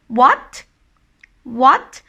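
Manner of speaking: "what" with a sharp questioning rise; surprised. Shown as a demonstration. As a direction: flick "what" upward in surprise. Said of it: "surprised of something".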